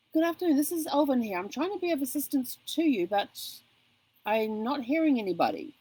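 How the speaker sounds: a hint of a baffled call-centre worker; puzzled call agent. Mimicking Alvin the agent who cannot hear her. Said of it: "he's going".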